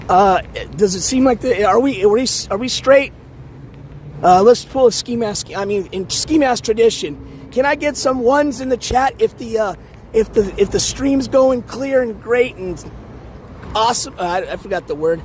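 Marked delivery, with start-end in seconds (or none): none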